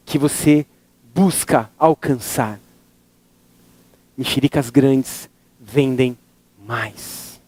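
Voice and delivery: closing lesson of a motivational talk; inspirational speech. Acted. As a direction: drop the voice and let each phrase land.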